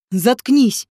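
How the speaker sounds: a touch of anger; mildly angry. A narrator's reading. Rodion shuts Zhenka up, mildly angry. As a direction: silence her with restrained irritation. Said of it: "разозлился Родион".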